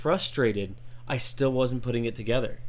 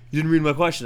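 complaining friend; 5 semitones higher, 6 dB louder, faster than usual. Retold or imitated.

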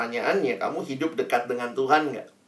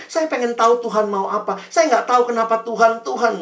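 high mock-pious student; lightly voiced pious teen; 7 semitones higher, 4 dB louder than usual. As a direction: parody the student calling Tuhan, pitched up. Mocking student's piety.

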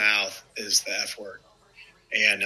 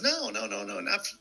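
imitating the manager; 8 semitones higher, 8 dB quieter than usual. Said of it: "And he goes".

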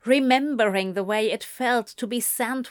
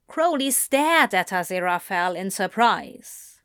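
ordinary delivery, nothing marked

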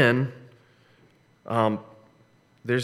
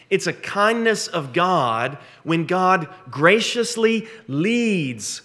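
slow emphasis; 5 semitones higher, 4 dB louder, slower than usual. Real, not acted.

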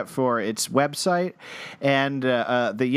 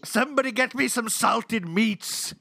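high, hammed-up pirate bark; mock pirate ordering the crew; pirate captain; 8 semitones higher than usual. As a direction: demand rations like a cartoon captain. Pirate demands rations.

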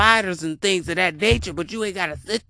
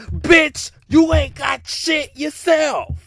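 higher, louder, slow retort; 9 semitones higher, 4 dB louder, much slower than usual. Real, not acted. Answering the women who call men broke, loud retort. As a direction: snap back at her, slow.

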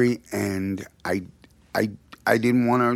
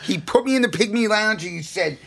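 ordinary talk

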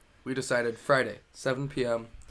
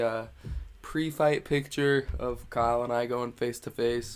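ordinary talk

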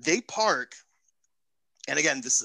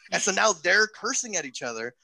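ordinary delivery

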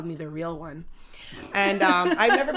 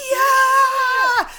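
very high, loud drawn-out shriek; near-crying excitement; 10 dB louder than usual. Retold or imitated. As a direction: imitate an overwhelmed contestant screaming with joy. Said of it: "she's almost crying".

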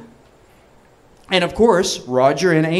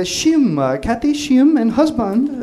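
higher-pitched imitation Korean accent; 8 semitones higher, slower than usual. Kathy booking a room by phone, mock Korean accent. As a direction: give the name in a put-on Korean accent. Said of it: "Korean accent".

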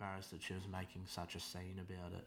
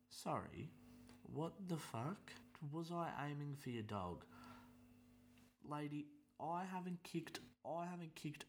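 incredulous; 8 semitones higher than usual. Retold or imitated. His incredulous retort.

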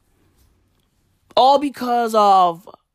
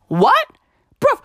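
shocked what. Real, not acted. Shocked at the money printing.

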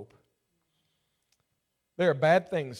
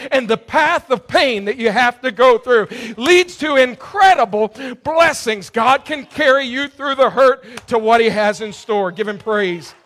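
loud, high, climbing sermon delivery; full-force preaching; 8 semitones higher, 15 dB louder than usual. Acted. Preacher urges the hurting congregation, full-force preaching. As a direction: preach it out to the back row.

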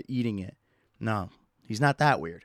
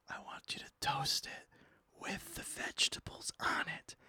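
conspiratorial whisper, no voice at all; 13 dB quieter than usual. Pretend. Whispering the toast request to the sandwich worker. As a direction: lean in and breathe it like a secret.

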